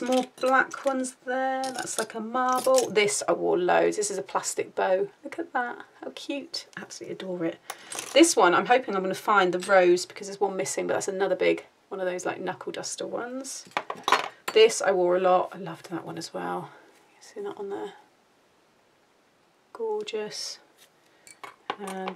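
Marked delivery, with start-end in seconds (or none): none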